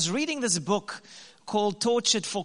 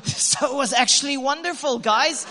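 laughing; 5 semitones higher, 5 dB louder than usual. Real, not acted. Laughing punchline to audience.

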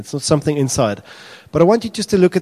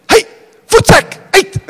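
high, loud shoo; shouting at a dog; 10 dB louder than usual. Acted. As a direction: yell at the dog to get out. Pastor chasing dog away.

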